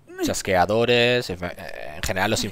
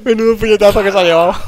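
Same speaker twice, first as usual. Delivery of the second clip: loud high laughing exclamation; 12 semitones higher, 10 dB louder, faster than usual. Real, not acted. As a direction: laugh through the words. Laughing at big punch.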